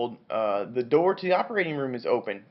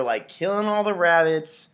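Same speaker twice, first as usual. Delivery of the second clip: ordinary talk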